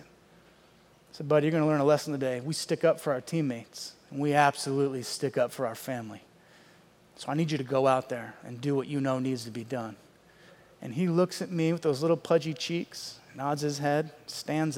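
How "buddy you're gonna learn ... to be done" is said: low, soft, solemn instruction; hushed coach sending in a secret weapon; quiet fatherly pep talk. Retold or imitated. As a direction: confide it gravely to a small boy. Father quietly instructs son in story.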